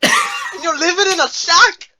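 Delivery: loud, high, drawn-out ogre scolding; Shrek. Pretend. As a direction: slowly bellow it in mock ogre outrage. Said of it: "He sounds like Shrek".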